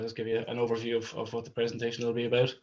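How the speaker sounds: ordinary delivery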